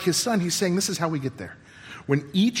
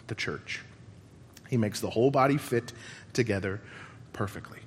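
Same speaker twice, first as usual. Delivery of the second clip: reverent scripture reading; 6 semitones lower than usual. Acted. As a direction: read it gently, letting each phrase settle. Reads scripture, fading reverently.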